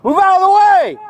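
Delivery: loud yell; shouting. Real, not acted. Deputy yelling at a bystander.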